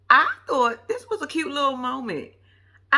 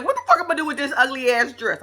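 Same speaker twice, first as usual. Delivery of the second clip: fast, loud, higher retold fury; mock outrage; 4 semitones higher, 5 dB louder, faster than usual. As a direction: imitate someone pissed, rattling it off. Acts out Sha's anger at dress.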